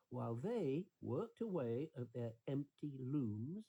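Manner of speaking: ordinary delivery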